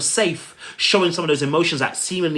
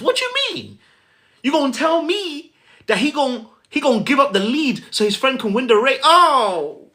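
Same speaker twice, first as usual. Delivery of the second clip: incredulous outburst; 9 semitones higher than usual. Retold or imitated. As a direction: play the stunned moviegoer who can't believe it. Mock disbelief at film sacrifice.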